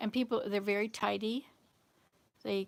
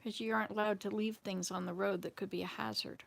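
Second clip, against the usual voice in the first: ordinary delivery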